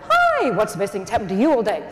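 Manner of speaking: big bright hello that drops into chatty interest; exuberant greeting. Shown as a demonstration. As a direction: greet them with gushing enthusiasm. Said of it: "saying it like this is important with the".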